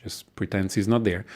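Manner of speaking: usual speaking voice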